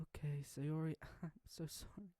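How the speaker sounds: high, tearful, breaking apology; crying apology. Acted. Monika apologizing to Sayori, crying. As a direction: choke the words out through tears. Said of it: "Monika cried as she said this".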